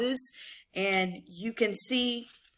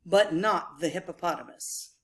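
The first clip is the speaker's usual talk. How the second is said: lilting storybook rhyme, higher than chat; animated rhyming story narration; picture book read-aloud; 4 semitones higher than usual. Acted. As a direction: read it to children with bouncy rhythm and dropped refrains.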